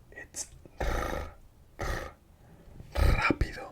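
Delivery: rápido with a trilled R at the start, whispered; rolled Spanish R. Shown as a demonstration. Teacher demonstrating the initial R in rápido, trilled whisper. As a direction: roll the r in rápido. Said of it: "if the R is at the start of the word".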